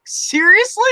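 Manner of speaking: very high, stunned disbelief; incredulous seriously. Retold or imitated. Reacting to the brother's cluelessness, very high and incredulous. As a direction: shoot it up in pure disbelief. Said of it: "And I'm like".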